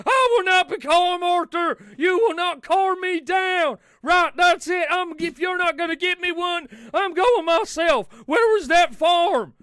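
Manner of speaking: loud, high, furious demand; red-faced outlaw shouting at the waiter; Dutch yelling. Acted. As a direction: bellow for a steak like an outraged cowboy. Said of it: "Dutch, come back".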